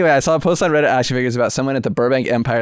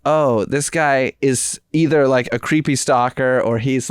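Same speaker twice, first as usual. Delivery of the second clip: usual speaking voice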